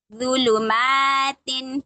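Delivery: ज़ुलुमातिन read very slowly; slow recitation. Shown as a demonstration. Teacher modelling a hard word for students. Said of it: "पढ़ के देखिए".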